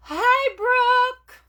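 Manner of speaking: high, loud squeal of greeting; gasp, then holler it out high and delighted; excited shout. Real, not acted. Calls out excitedly to friend.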